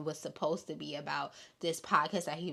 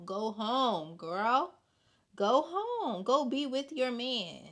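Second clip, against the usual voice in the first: higher, sassy push: go home; teasing urging; 4 semitones higher, slower than usual. Retold or imitated. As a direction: shoo her off with sass. Teasing advice to Portia.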